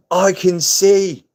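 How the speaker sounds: high, loud, drawn-out movie quote; mock exultant cry. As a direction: cry it out like a hammed-up miracle. Blind character suddenly gains sight.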